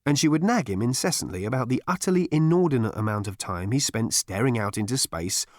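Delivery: ordinary delivery, nothing marked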